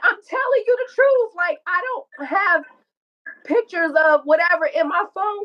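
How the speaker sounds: high-pitched imitated plea; upset re-enactment, pitched high; retold pleading denial. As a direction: act out begging to be believed.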